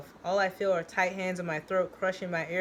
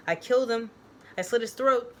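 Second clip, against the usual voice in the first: raised confession; 6 semitones higher, 6 dB louder than usual. Acted. Tommy confessing.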